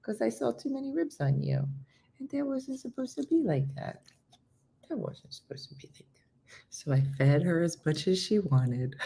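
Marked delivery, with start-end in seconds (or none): 0.0-4.0 high, soft pet talk; cooing to dog. Real, not acted. Owner to dog about too many ribs, high coo. Coos to her once-skinny dog. 4.9-6.5 near-whispered coo; whispered pet talk. Real, not acted. Owner to once-skinny dog, whispered coo.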